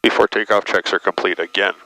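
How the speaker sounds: normal talking voice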